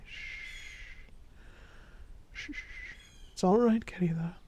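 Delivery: soft, hushed comfort, partly whispered; soothing reassurance. Acted. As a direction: shush and calm someone frightened, very softly. Soothing a scared, crying partner.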